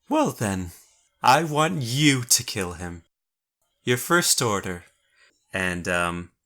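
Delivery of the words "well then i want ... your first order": cold queen. Acted. Queen commands the killing.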